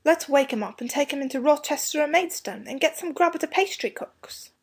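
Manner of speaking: put-on eager boy's suggestion, pitched high; hopeful schoolboy voice. A narrator's reading. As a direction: pitch it up like a hungry, hopeful boy. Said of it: "said Robert hopefully".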